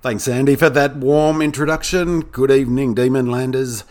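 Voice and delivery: loud, high, pumped-up greeting; fired up. Acted. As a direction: boom it out like a hyped host. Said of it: "I thought I'd get a bit more fired up tonight".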